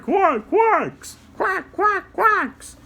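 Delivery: high cartoon quacking; squeaky duck sounds; duck quacks. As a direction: quack like little ducklings. Imitating the ducklings.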